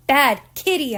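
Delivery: loud scolding shout at the cat. A narrator's reading. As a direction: yell at the kitty. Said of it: "I shouted".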